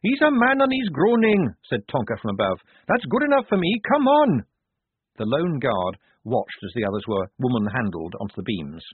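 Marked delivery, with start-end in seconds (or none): 0.0-1.5 high, loud dismissal; Tonka's brusque retort. A narrator's reading. Tonka answers from above. 2.9-4.4 high, loud, brisk call; Tonka urging on. A narrator's reading. Tonka urges them on from above.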